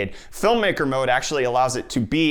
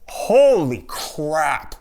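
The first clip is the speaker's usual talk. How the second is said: very slow, drawn-out awe; real amazement; 4 semitones higher, 5 dB louder, much slower than usual. Real, not acted. Seeing eight K footage on the TV, amazed. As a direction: stretch both words out, louder and higher, stunned.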